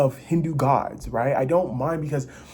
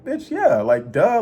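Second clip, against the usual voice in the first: sassy; 3 semitones higher, 7 dB louder, much slower than usual. Retold or imitated. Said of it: "now I'm like".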